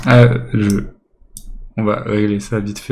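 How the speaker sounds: son saturé, micro qui crache; voix distordue par la saturation. Real, not acted. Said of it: "le son saturé". (micro saturé, son distordu)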